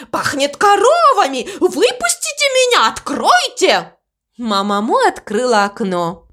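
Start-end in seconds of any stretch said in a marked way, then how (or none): 0.1-3.9 high, frantic cry to get out; crow shouting. A narrator's reading. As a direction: call out desperately, pitch way up. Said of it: "кричал он".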